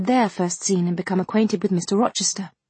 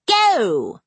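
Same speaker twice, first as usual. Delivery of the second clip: loud, higher curt command; sharp exclamation; 4 semitones higher, 4 dB louder than usual. A narrator's reading. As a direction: bark the order at the footman. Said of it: "ejaculated Miss Ingram".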